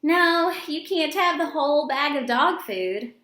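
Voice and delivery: normal talking voice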